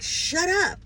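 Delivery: high sharp mock yell at her own ego; angry snap at herself. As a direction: snap it shut, fed up with yourself. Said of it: "I was yelling at myself".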